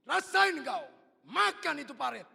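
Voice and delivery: high, loud, slow taunt; mock scolding shout. Father pretending to taunt his fallen son, loud shout. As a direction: yell it as mock punishment. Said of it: "Apa saya teriak".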